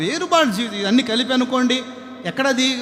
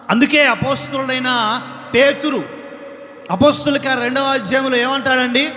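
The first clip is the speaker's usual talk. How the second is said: building pulpit sermon, louder and higher; fiery preaching; 4 semitones higher, 4 dB louder than usual. Acted. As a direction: preach it harder line by line. Preacher builds to full force.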